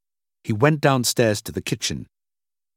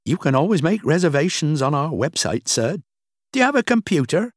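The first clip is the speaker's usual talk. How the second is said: high-pitched, courteous receptionist voice; polite hotel clerk; 7 semitones higher than usual. Acted. Clerk answering a shouting guest, polite high voice.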